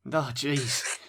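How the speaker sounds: ordinary delivery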